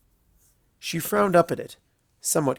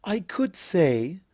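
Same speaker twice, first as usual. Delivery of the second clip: sly; 6 semitones higher, much slower than usual. A narrator's reading. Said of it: "her lips curled into a half smile".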